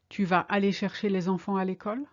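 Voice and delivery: fast, fluent, a rising end; quick, natural. Shown as a demonstration. Teacher giving the full question at natural speed. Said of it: "Are you going to collect the kids from school?".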